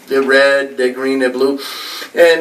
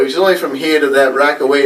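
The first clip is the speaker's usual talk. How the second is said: ordinary talk, nothing marked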